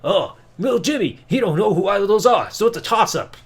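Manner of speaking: a light, fast put-on R-Truth voice; imitating R-Truth. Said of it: "R-Truth is like".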